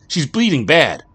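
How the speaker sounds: high, loud, fast panic; a mother desperate to reach the hospital; panicked cry. Acted. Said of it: "Patty cried".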